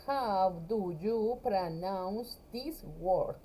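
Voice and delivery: drawn out, every word separate; slow repetition. Shown as a demonstration. Teacher repeats the English question slowly for her class. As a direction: say it slowly so students can repeat. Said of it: "¿Cómo pronuncias esta palabra?".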